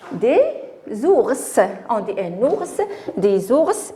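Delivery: ours with an audible s at the end; final S pronounced. Shown as a demonstration. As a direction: sound the S ending ours. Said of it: "on prononce". (ours avec le S final prononcé)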